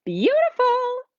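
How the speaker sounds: very high, loud, stretched-out Beautiful; ecstatic, drawn-out cry of delight; delighted gush. Real, not acted. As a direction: swoon over it, slow and soaring. Gushing over card image.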